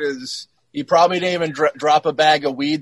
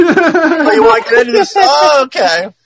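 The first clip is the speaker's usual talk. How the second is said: loud and laughing; real amused excitement; 9 semitones higher, 9 dB louder than usual. Real, not acted. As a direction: tease back while laughing. Laughing reply to Alex.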